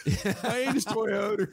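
slow, laughing "Hyannis Toyota" with an R on the end; Baltimore accent. Retold or imitated. Cohost mimics the Toyota ad, laughing Baltimore accent. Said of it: "they put an R at the end".

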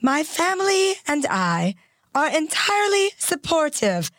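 put-on measured, British-accented elderly monarch; Queen's specific cadence. Co-host reading the Queen's statement aloud, in stately cadence. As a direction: deliver it as a formal royal statement with careful pauses. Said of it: "she speaks in a very specific cadence".

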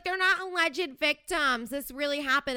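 mock the smug commenter. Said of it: "He's like".

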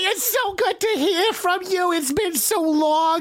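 high, hammed-up gushing voice; mock gushing welcome. Teasing welcome for the returning cohost.

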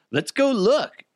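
high, excited put-on voice; eager mock suggestion. Husband eager to go see the neighborhood fire, mock excited. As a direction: pitch it up like an eager onlooker.

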